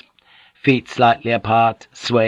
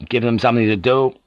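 usual speaking voice

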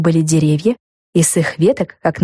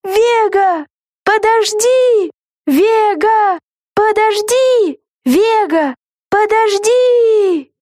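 high, slow, pleading calls; girl calling after friend; much slower than usual. A narrator's reading. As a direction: call her back, desperate. Lucy runs calling after Vega.

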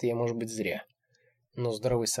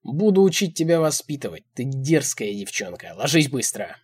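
angry command; 3 semitones higher, 7 dB louder than usual. A narrator's reading. Old man scolds a defiant woman, angry command. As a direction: lay down the law loudly.

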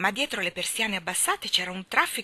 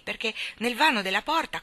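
plain reading voice

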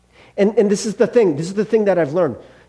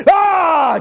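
angry shout; 10 semitones higher, 5 dB louder than usual. Retold or imitated. Honking, getting mad in traffic.

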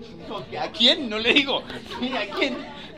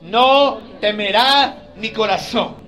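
slow, louder confident recitation; confident declaration; 3 semitones higher, 7 dB louder, slower than usual. Shown as a demonstration. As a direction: say it like you trust it. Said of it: "usted puede decir confiado".

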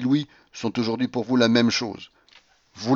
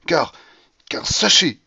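louder, high-pitched agitated outburst; trembling with emotion; 6 semitones higher, 5 dB louder, slower than usual. A narrator's reading. Monsieur Astley rebukes the lost gambler, voice meant to tremble. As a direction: burst out with pent-up emotion. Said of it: "d'une voix tremblante".

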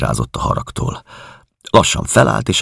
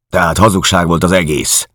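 louder, higher accusing question; angry accusation; 5 semitones higher, 5 dB louder than usual. Acted. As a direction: confront them with the lie. Georg confronting them angrily.

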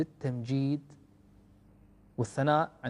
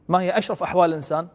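ordinary talk

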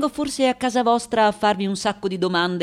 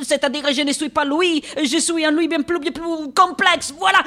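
high, flustered rush of self-justification; excitable, high-pitched insistence; agitated explaining; 8 semitones higher than usual. Acted. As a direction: get worked up while explaining yourself. Flustered switch into French explanation.

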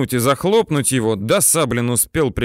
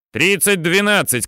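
higher-pitched military command; 6 semitones higher than usual. A narrator's reading. Tankman ordering the aim to gunner, commanding call. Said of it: "командует танкист".

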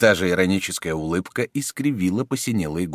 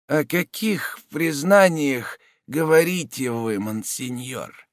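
slow mocking whisper; weak mocking voice; 8 semitones higher, slower than usual. A narrator's reading. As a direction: whisper it slowly, weak but sneering. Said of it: "слабым, но насмешливым голосом".

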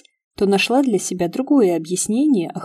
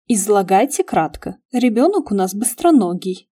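ordinary delivery, nothing marked

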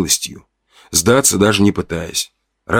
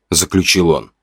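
plain reading voice

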